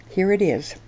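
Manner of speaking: ordinary delivery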